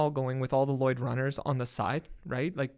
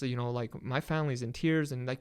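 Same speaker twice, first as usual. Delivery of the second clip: ordinary talk, nothing marked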